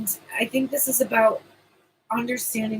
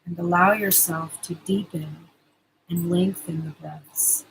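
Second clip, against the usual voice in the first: guided meditation. Acted. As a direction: soothe them into calm, unhurried. Leads the promised meditation.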